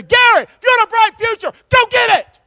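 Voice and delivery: high, loud booming call; God's command. Acted. God speaking to the pastor, loud high command. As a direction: thunder it down like a voice from above. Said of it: "This is God saying to Gary".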